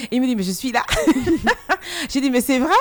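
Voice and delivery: loud, high, breaking into big laughs; laughing retelling. Retold or imitated. Singer recalling the artist's answer to an interviewer, laughing loudly. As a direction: retell the exchange through bursts of laughter.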